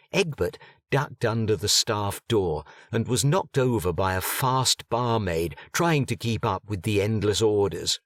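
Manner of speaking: plain reading voice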